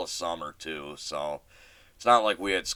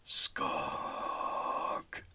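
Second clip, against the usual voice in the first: barely voiced, tense hiss of a single word; whispered warning; 5 semitones lower, 7 dB quieter than usual. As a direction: whisper it so the skunk won't hear. Friend spots skunk at night.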